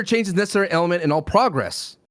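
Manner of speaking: "progress" with an open AH after "all"; PRAH-gress pronunciation. Shown as a demonstration. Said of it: "it depends on the sentence".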